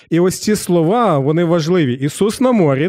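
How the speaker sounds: ordinary talk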